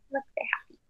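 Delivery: happy whisper. Real, not acted. Teacher whispers to class.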